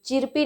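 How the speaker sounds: चिरपी with short "i" and sounded R; mispronounced chirpy. Shown as a demonstration. Teacher rejecting a misreading, चिरपी with audible R. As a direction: say the faulty "chir-pee", चिरपी. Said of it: "चिरपी नहीं होता".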